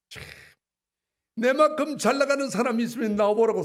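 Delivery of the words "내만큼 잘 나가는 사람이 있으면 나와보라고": shout. Real, not acted. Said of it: "소리 지르고 싶어요".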